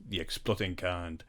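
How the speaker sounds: put-on low, quiet French-accented deadpan; a hint of Clouseau's French accent. Clouseau's answer in a retold gag.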